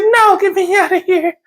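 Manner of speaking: high panicky wife voice; high-pitched mock-panicked wife. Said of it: "She's like".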